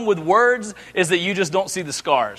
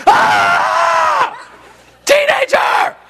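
very high, louder hammed-up exasperated scream; frustrated yell; 4 dB louder, much slower than usual. Dad losing it at son, mock frustrated scream. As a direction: shriek it like a parent at the end of their rope. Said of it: "I'm like".

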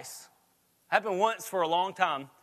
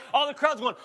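usual speaking voice

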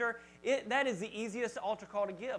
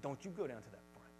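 low, much softer aside; hushed warning; 8 semitones lower, 13 dB quieter than usual. Retold or imitated. Pastor warning his kids in his church, low and much softer. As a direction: warn your own kids under your breath.

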